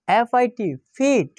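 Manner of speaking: F-I-T spelled out, then ফিট said as fit. Shown as a demonstration. Teacher showing students the short i, spelling then saying fit. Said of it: "যেমন আমি এখানে কয়েকটি শব্দ লিখেছি".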